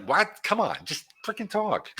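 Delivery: high-pitched, slow disbelief; exasperated. Real, not acted. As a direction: come on, just talk, fed up. Exasperated at scripted salespeople.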